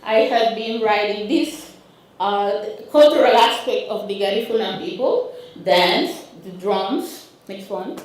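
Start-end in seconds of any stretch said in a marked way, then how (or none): none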